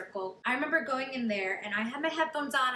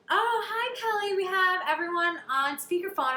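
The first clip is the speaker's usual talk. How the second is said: much higher and louder, sing-song greeting; bubbly agent; 7 semitones higher, 4 dB louder than usual. Retold or imitated. Agents on speakerphone calling Kelly, bubbly and high. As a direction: tease the news like a gleeful agent.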